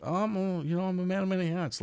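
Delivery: high, quick, playful evasion; coy hedge. Retold or imitated. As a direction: dodge the question playfully. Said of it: "I'm like".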